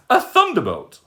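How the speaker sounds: put-on high, loud alarmed old lady; alarmed cry. Old lady crying out when something falls at her feet. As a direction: shout in alarm at the thump. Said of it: "cried the old lady".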